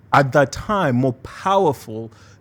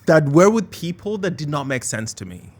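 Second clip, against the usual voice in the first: ordinary talk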